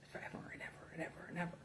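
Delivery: rapid whispered chant; quick hushed ever and ever; fast whisper. Real, not acted.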